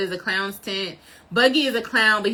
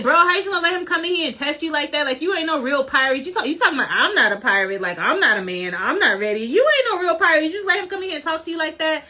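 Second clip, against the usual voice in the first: higher, rushed mock outrage with swooping pitch; retold pissed-off rant; 5 semitones higher, faster than usual. Fan acting out Luffy pissed at Shanks, indignant. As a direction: scold in disbelief, acting someone else's anger. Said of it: "So Luffy gets pissed".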